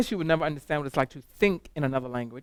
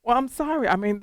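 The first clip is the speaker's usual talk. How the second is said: mock-apologetic, pitched much higher; sing-song sarcastic sorry, higher; 8 semitones higher, 4 dB louder than usual. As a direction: fake apology with an edge. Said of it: "And I'm thinking".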